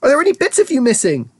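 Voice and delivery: high, frantic questioning; anxious cry. A narrator's reading. Mother checking on her tiny son, crying out. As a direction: cry out, fearing for your child. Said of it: "cried Missus T V".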